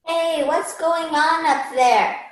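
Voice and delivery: distant, drawn-out call to the others; calling upstairs. Acted. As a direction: call up the stairs, stretching the words.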